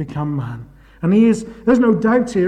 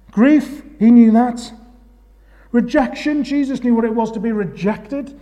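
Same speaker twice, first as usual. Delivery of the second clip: higher and louder impassioned preaching; preaching at full force; 7 semitones higher, 4 dB louder than usual. Acted. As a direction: build each question to the congregation. Escalating preaching, high and loud.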